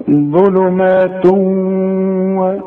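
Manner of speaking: ظلمات و with the tanween held nasal into و, very slow; ghunna merge. Shown as a demonstration. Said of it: "شوف أهو ده الإدغام". (ظلمات و بإدغام بغنة ممدودة)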